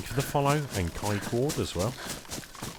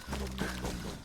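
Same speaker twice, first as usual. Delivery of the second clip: low, very soft nom nom chewing noises; munch it down low and quiet; mock munching; 8 semitones lower, 13 dB quieter, faster than usual. Streamer pretending to eat lunch, low soft munching noises.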